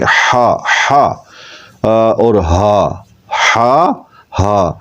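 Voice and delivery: ہا and ہا, throaty then chesty; contrasting h sounds. Shown as a demonstration. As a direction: drill the two h sounds side by side. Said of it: "فرق کرنے کے لیے".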